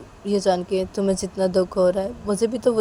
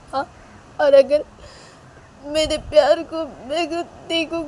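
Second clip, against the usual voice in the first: higher, louder tearful outburst; crying protest; 8 semitones higher, 5 dB louder than usual. Acted. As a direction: cry it out, pleading through the sobs. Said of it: "रोते रोते".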